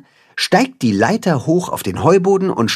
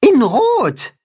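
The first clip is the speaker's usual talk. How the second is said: very high little mole voice; mole calling out. A narrator's reading. Mole Max adds a detail about the sign, calling. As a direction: pipe up with one more finding. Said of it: "ruft der Maulwurf".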